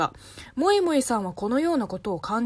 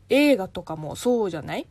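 ordinary talk

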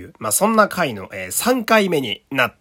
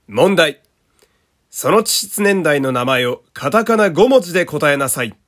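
loud, punchy quiz announcer read; quiz narration voice; 3 semitones higher, 6 dB louder than usual. Shown as a demonstration. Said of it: "ちょっとナレーションっぽく読んでいきたいかなと". (クイズ番組のナレーション)

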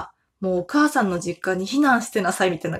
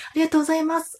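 campaign thanks; 8 semitones higher, much faster than usual. Retold or imitated. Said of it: "みたいな感じでね".

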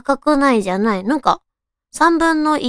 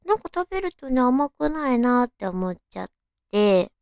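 normal talking voice

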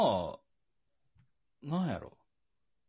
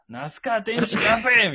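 mock challenge shout; 9 semitones higher, 7 dB louder, slower than usual. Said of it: "みたいな".

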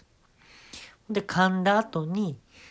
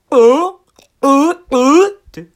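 loud, high, slow retch mixed with a moan; half vomit, half moan; 11 semitones higher, 16 dB louder, much slower than usual. Retold or imitated. Comedian mixing retching and moaning onstage, loud retching moan. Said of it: "嘔吐と喘ぎ声を混ぜていきます".

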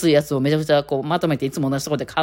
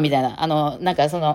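normal talking voice

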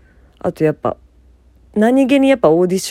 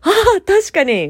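sudden realization; 14 semitones higher than usual. Retold or imitated. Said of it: "みたいな".

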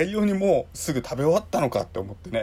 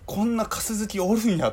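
a higher, quick Kansai-dialect tsukkomi; Kansai dialect retort; 9 semitones higher than usual. Retold or imitated. Amazed at a stranger's huge tenkasu pile, Kansai-dialect retort. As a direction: snap back in Kansai dialect at the surprise. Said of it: "今関西弁で突っ込んじゃいました".

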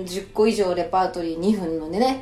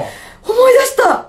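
loud, high, drawn-out exclamation; sudden excited outburst; 14 semitones higher, 7 dB louder, much slower than usual. Real, not acted. Guest suddenly remembers an old encounter, excited high shout. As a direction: burst out slowly as the memory hits. Sudden recollection announced mid-talk.

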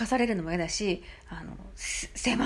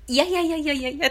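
flustered denial; 6 semitones higher, 6 dB louder than usual. Retold or imitated. Waving off the apology.

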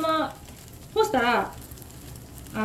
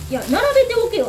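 higher and louder, barking an order; rude order; 7 semitones higher, 4 dB louder, faster than usual. Retold or imitated. The customer bossing the shop owner about, a gruff mock order. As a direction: play the pushy customer ordering her about. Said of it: "って言われたんですね".

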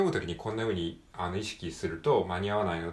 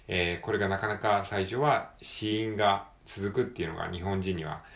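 ordinary talk, nothing marked

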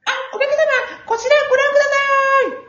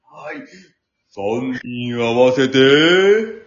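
ordinary talk

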